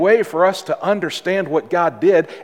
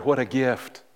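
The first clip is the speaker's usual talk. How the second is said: normal talking voice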